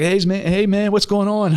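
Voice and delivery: high, upbeat imitation of a buddy picking up; mock-bright hello on the phone; cheery phone greeting. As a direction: mimic a friend happy to hear from you.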